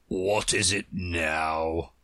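lightly voiced slow, low old frog; deep hoarse whisper. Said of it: "in a deep, hoarse whisper".

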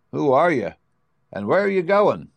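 a hint of a bird's voice asking strangers; stork's question. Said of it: "asked the stork".

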